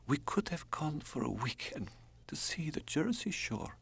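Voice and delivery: Drenka, whispering. Acted. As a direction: whisper it like a dying woman's wish. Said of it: "said Drenka".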